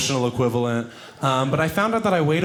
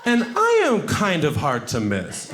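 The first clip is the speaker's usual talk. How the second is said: normal talking voice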